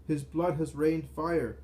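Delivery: slow, loud, fearful wail; terrified cry. Acted. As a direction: cry it out in dread. Terrified crowd cries out.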